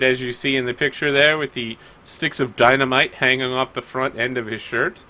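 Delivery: ordinary talk, nothing marked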